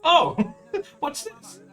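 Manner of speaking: high, slow laughing oh; laughing surprise. Real, not acted. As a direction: laugh as you spot it. Laughing discovery.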